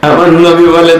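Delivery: usual speaking voice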